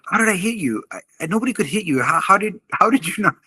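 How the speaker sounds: high, fast, panicky disbelief; terrified kid. Retold or imitated. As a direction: imitate a stunned scared kid blurting it out. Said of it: "he's terrified".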